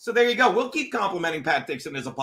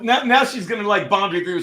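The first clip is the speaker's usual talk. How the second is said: ordinary talk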